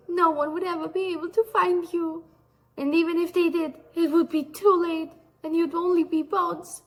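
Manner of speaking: high-pitched, playful menace; mock death threat. Gnome threatening the man she loves, mock menace. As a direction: threaten him in a high, put-on voice. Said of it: "death threats".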